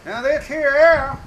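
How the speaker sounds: high, loud punch on "arrow"; "arrow" pushed up high for emphasis. Shown as a demonstration. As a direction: hit "arrow" hard and loud. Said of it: "I emphasize the arrow".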